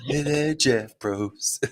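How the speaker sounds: slow, drawn-out mock greeting to Jeff; mock host greeting. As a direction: greet him like a cheesy TV host.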